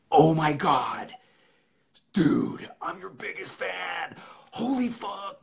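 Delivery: high, drawn-out mock fanboy yell; raging fan. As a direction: imitate a starstruck fan losing it. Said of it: "fucking starts raging".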